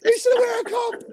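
very high shouted retort; mock heckling reply. Answering a wrestler hit in the nuts, mock shout. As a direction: call it out in a shrieky imitation. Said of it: "He's like".

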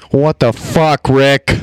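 shouting into the mic; yelling. Real, not acted. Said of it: "now we're yelling".

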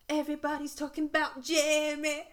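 high, loud go at a northern accent; attempted northern accent. Shown as a demonstration. Quoting a musical's title, attempted northern accent. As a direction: put on a shaky-grasp northern English accent. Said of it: "I'm trying to do a northern accent".

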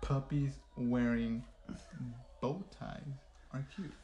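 broken into chunks, fading; word by word, trailing soft. Shown as a demonstration. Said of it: "broken into chunks for lip reader".

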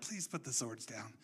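hushed, low voice after the commotion; calm plea. Retold or imitated. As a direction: quietly ask them to stop. Retold calm plea amid swordplay.

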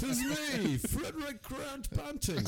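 mock British. Friends mocking 1920s Banting, high slow mock British voice. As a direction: ham up an old British gentleman. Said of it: "he's not British".

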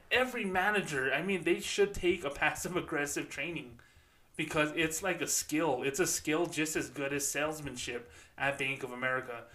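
ordinary talk

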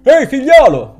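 loud, high call across the street; shout. Acted. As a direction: yell at the young man to get his attention. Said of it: "gridò uno dei due".

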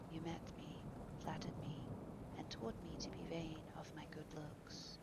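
put-on soft, breath-edged young man's voice; Dorian, subdued recollection. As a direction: recall it quietly, almost in a whisper. Dorian's long bitter recollection.

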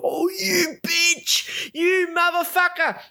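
very high, drawn-out outraged squeal; hammed-up high-pitched fury; furious. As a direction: re-enact yelling abuse at the screen in a strained high voice. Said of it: "I was furious. I was sitting there going".

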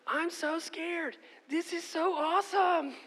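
put-on high, slow child squeal, scared and thrilled at once; thrilled little girl screaming down the first drop. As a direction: shriek it like a six-year-old on a coaster. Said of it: "She starts screaming".

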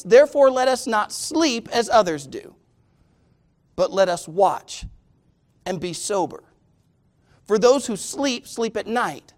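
slow, deliberate Bible reading with weighty pauses; scripture reading. Real, not acted. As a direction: read the passage out measuredly, lifting key words. Reads scripture to congregation.

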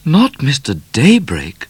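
high, surprised cry; astonished at the name; high surprised question; cried out. A narrator's reading. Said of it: "cried Miss Laura".

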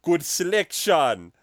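slow, loud mock Jamaican accent. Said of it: "I guess it was more Jamaican".

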